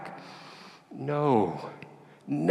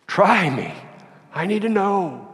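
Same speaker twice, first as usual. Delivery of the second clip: higher and louder, pressing hard; urgent insistence; 8 semitones higher, 6 dB louder than usual. Retold or imitated. Priest urging the beggar to tell, high and urgent. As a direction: demand to know, almost pleading.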